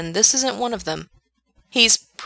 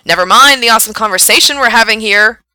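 loud, pointed complaint lifting in pitch; voice rising at the end; 4 semitones higher, 9 dB louder than usual. A narrator's reading. Frank protests to his booth, loud with rising voice. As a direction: aim it at the booth, climbing towards the end. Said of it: "His voice goes up at the end of that".

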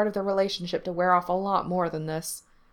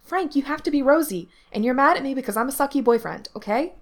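put-on higher, louder, insistent kid; earnest insistence; 7 semitones higher, 5 dB louder than usual. A narrator's reading. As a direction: instruct a friend with great seriousness. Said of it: "Gerard was saying seriously".

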